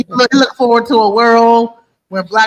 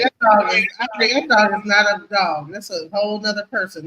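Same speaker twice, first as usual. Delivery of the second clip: ordinary talk, nothing marked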